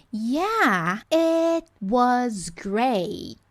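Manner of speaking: "it was great" very slow, falling; slow movie-style line. Shown as a demonstration. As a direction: drawl it like the clip. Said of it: "olha só".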